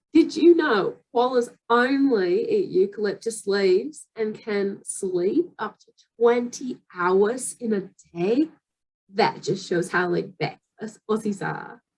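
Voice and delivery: slow, lilting Australian accent; imitated Australian video voice with rising phrase ends. Shown as a demonstration. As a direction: copy an Aussie wildlife presenter. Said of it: "speaking with Australian accent and I try to copy".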